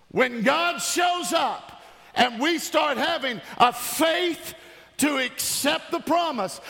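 high, building, insistent prayer; impassioned preached prayer. Real, not acted. Pastor praying breakthrough over the church, impassioned and high. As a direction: climb to a ringing peak, then ease.

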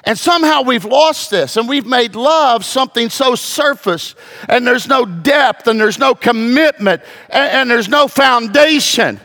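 high-pitched fervent sermon delivery, driving each point home; preaching at full force. Acted. Pastor urging his congregation to fear and love God, fervent preaching.

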